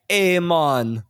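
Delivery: put-on Jamaican greeting; joke Jamaican accent. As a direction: imitate a bad actor's Jamaican accent. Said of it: "joke Jamaican accent".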